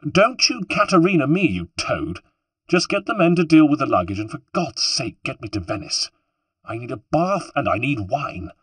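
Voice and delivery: high-pitched scolding wife; acted exasperation, starting high; irritated retort. A narrator's reading. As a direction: cut him off and order him about crossly. Wife snapping back in the row.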